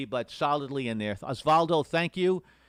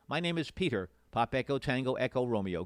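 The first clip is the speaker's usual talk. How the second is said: ordinary talk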